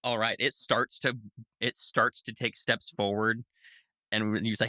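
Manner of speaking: ordinary talk, nothing marked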